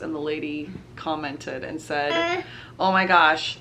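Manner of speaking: usual speaking voice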